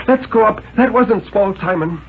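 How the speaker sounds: jumpy woman hurrying upstairs in fear; frightened Mrs Carter. Acted.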